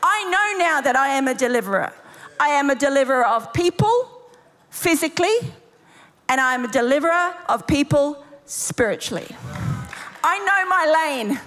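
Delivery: high, emphatic preaching with conviction; full-force preaching. Real, not acted. Preacher declaring deliverance over the congregation, high and emphatic. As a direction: declare it over them with authority.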